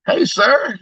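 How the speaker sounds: high, bright-pitched greeting; customer service voice. Retold or imitated. Tire man meeting new people, high customer service greeting. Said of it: "I do use my YouTube voice in my business".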